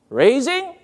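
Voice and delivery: RAISING lifted high with a rising end. Shown as a demonstration. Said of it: "Pitch is the raising".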